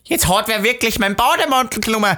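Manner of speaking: high-pitched outraged woman, imitated; aggressive shouting. Woman discovering her bathrobe is gone, imitated aggressive shout. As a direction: mimic a woman yelling, worked up to the limit. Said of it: "wie die Frau laut schreit".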